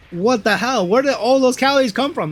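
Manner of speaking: high, loud disbelieving yell; outraged outburst. Real, not acted. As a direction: yell it out in real disbelief at the label. Outraged at snack calories.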